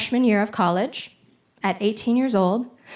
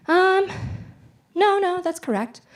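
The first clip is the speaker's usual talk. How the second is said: hesitant mom; 9 semitones higher than usual. Retold or imitated. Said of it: "she goes".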